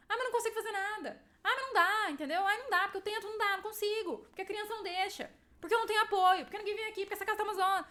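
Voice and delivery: high, whiny mock complaint; whiny complaining. Mom mimics an always-complaining mother, high and whiny. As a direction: pitch it up into a nagging moan.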